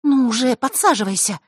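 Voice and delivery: hurrying friend. A narrator's reading. Diana hurries her dazed friend to sit down, high urging voice. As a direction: urge her along in a bright high voice. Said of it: "поторопила Диана".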